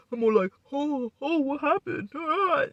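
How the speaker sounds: high, hammed-up fake shock; mocking dramatic Marty. Mocking Marty's dramatic act on the live feeds, high and hammed up. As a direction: overplay the gasp to mock him. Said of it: "and I'm so dramatic".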